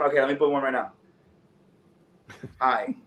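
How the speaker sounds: normal talking voice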